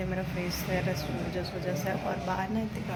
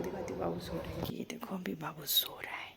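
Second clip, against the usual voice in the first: whispering; 2 semitones lower, 4 dB quieter than usual. Real, not acted. Mother shows her sleeping baby, hushed whisper. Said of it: "मैं धीरे धीरे बोल रही हूँ क्योंकि सो रहा है".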